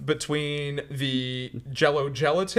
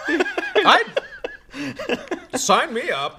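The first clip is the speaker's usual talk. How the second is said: laughing; 8 semitones higher, 5 dB louder than usual. Real, not acted. Laughing, enthusiastic.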